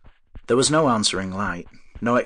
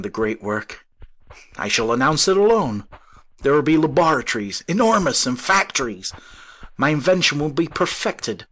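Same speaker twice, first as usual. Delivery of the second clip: raised, forceful, proud declaration; indignant; 5 semitones higher, 5 dB louder than usual. A narrator's reading. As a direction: announce it defiantly to the doubter. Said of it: "The inventor was suddenly indignant".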